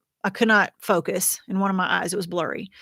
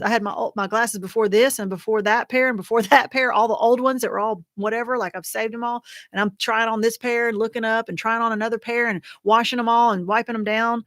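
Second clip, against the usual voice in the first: ordinary talk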